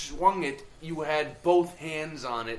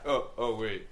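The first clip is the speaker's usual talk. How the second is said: normal talking voice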